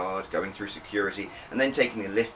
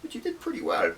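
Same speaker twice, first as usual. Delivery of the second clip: much higher, sweet and teasing; smiling praise. Acted. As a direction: smile through the compliment. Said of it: "giving him a smile".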